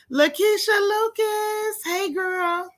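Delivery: high, drawn-out hello to a friend; high excited shout-out; excited greeting. Real, not acted. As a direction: sing out her name. Greeting a viewer.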